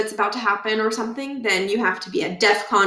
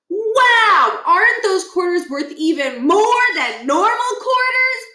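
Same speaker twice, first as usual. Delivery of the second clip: put-on much higher, louder thrilled kid; Stuart's wow; 11 semitones higher, 4 dB louder, slower than usual. Stuart marvelling at the bicentennial quarter. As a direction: gush over the coin.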